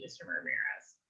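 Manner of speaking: breathy teasing whisper of his name; a sly, coy hush; flirty whisper. Acted. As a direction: lean in and whisper it playfully. Flirting close up.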